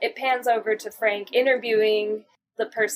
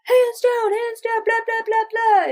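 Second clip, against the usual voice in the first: high excited gushing; 13 semitones higher, 4 dB louder than usual. Retold or imitated. Carl gushing, retold.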